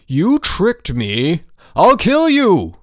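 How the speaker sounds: high, very loud, slow furious yell. A narrator's reading. George yelling at his roommate for tricking him, furious yell. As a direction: roar it at the trickster.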